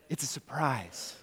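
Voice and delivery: put-on soft, hushed little boy's secret; secretive little boy. Boy tells his mom it's a surprise, hushed.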